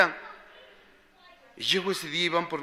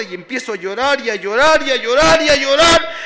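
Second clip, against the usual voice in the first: repetition climbing higher and louder; building anguish; 8 semitones higher, 11 dB louder than usual. Retold or imitated. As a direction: build to an anguished, loud peak. Relives his breakdown, building intensity.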